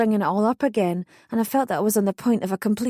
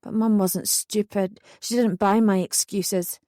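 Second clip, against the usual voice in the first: usual speaking voice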